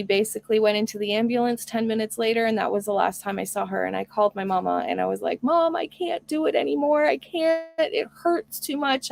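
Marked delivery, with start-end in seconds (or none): 5.4-9.1 upset plea. Retold or imitated. Telling mom on the phone she can't go on, near tears. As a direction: relive the call to mom, aching.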